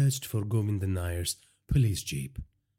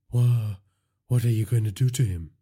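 rushed, stammered alarm; panicking; 2 semitones higher, 4 dB louder than usual. A narrator's reading. Nair asks what Virat will do to his boss, panicking. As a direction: stumble on the first word, then hurry the question out. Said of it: "Nair was panicking".

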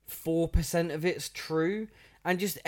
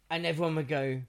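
ordinary talk

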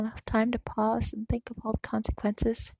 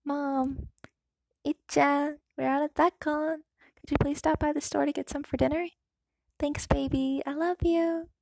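lightly voiced mother; 4 semitones higher than usual. A narrator's reading. Mother's text voiced in story.

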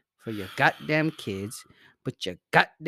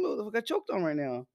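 usual speaking voice

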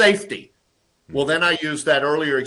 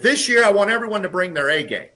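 higher, louder proclamation; CEO proclaiming; 7 semitones higher, 5 dB louder than usual. Retold or imitated. CEO announcing the yearly motto, raised voice. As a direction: announce it to the whole company. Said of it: "the C E O had said".

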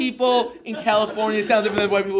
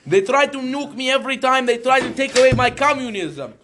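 higher, forceful mock Russian accent; 5 semitones higher than usual. Russian protesting Americans and capitalism, indignant Russian accent. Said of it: "We're in Russia now".

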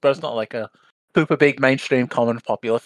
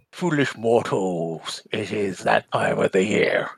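booming demon lord; 5 dB quieter, much slower than usual. Shown as a demonstration. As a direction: drawl it like a haunted house ghost host. Spooky Halloween host greeting.

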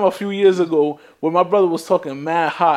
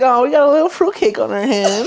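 mock aunt voice; 6 semitones higher than usual. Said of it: "She was like".

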